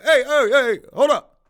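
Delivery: loud, high call to the crew; hey, hold up, called out over the hammering; calling out to stop. Retold or imitated. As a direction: shout them down to halt the nailing.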